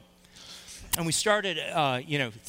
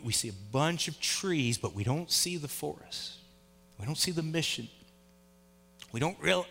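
low, soft, earnest sermon voice; hushed preaching; 7 semitones lower, 6 dB quieter than usual. Acted. Pastor urges the church to unite, soft earnest preaching. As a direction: draw the congregation in quietly.

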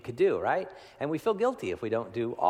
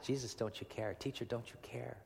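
hushed plea; 9 dB quieter than usual. Retold or imitated. Disciples' plea retold.

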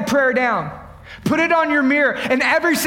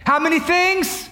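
usual speaking voice

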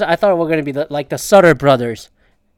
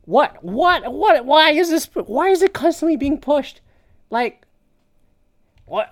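higher, building what what what; rising disbelief; 8 semitones higher than usual. Real, not acted. Mocking the commenter's question, rising disbelief. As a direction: mount the disbelief with each what.

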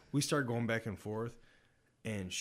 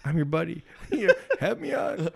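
laughing mock plea; 8 semitones higher, 10 dB louder, faster than usual. Quoting his own plea, laughing.

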